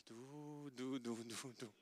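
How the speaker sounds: very soft, hushed little murmur; Carl Pinard's little voice. Acted. Preacher as the little voice inside you, very softly soothing. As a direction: coo it barely audibly, like a tiny inner voice. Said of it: "la petite voix de Carl Pinard qui te parle".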